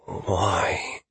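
soft, breathy inner voice; hushed, eerie question in the mind; alien thought. Acted. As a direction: let the word drift in quietly. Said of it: "The alien thought caused it".

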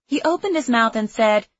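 plain reading voice

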